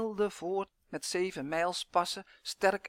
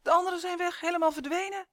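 high, raised cry of dismay; alarmed call; 12 semitones higher, 5 dB louder, faster than usual. A narrator's reading. Elf finding the others gone, alarmed cry. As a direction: call out the bad news, high-pitched. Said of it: "riep Uf opeens".

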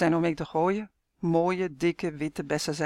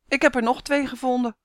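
higher, fast call from window; 7 semitones higher, faster than usual. A narrator's reading. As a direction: call down from upstairs. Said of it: "roept meneer Smit". (roepen vanuit het raam)

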